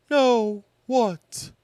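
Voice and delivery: wondering Pooh. Acted. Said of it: "wondered Pooh".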